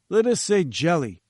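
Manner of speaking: a hint of a haughty scientist, lofty; lightly voiced pompous professor. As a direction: read it like a grand, self-important professor. Challenger dismissive.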